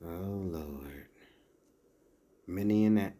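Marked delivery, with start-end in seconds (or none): none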